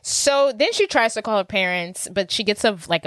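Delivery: ordinary talk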